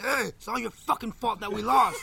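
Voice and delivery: high mock angry yell; imitated yelling. Retelling friend yelling at Ben after a loss, mock furious. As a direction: yell the blame like the upset friend. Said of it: "he starts yelling at Ben".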